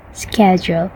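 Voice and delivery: American "schedule" with a hard k; American accent. Shown as a demonstration. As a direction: say "schedule" the American way, "sked-jool", softly.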